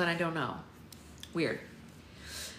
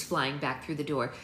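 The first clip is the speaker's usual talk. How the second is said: usual speaking voice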